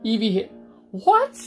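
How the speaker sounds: usual speaking voice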